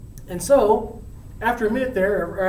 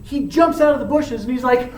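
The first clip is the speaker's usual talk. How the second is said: ordinary talk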